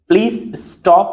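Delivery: normal talking voice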